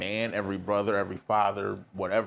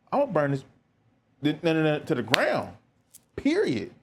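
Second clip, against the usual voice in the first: fired-up threat; 5 semitones higher than usual. Retold or imitated. To the brother with a problem, a fired-up promise to burn it down. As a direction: hype yourself up to torch it, ending on a high yelp.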